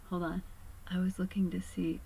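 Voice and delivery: breathy whisper to herself; quick hushed aside while reading. Real, not acted. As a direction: whisper it under your breath while checking. Aside while checking something.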